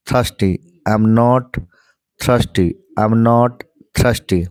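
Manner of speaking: I'm not thirsty, very slowly repeated; very slow drill. Shown as a demonstration. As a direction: repeat it slowly for learners to follow. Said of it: "five times बोलना है". (धीमी रफ़्तार में दोहराना)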